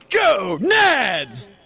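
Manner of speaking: loud, high cheer, half laughing; cheering yell. Retold or imitated. Boy cheering for gonads on the porch, loud yell. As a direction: belt it like a cheerleader at full volume. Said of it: "just yelled as loud as I could".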